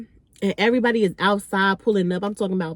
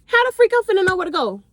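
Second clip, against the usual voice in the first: exasperated; 10 semitones higher, 3 dB louder than usual. Retold or imitated. Frustrated at missing address.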